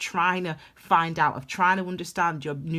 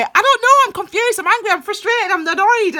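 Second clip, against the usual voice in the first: much higher, loud list of frustrations; frustrated reply; 13 semitones higher, 9 dB louder than usual. Retold or imitated. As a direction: fire off each feeling like a complaint. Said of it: "And I'll say".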